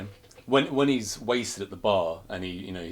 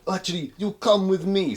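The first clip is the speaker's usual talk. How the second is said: mock Russian accent; 10 semitones higher, 6 dB louder than usual. Krichkov explaining to the Wishmaster, mock Russian accent. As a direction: drag it out like a nervous Russian gangster.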